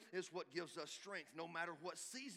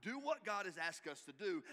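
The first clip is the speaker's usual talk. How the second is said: usual speaking voice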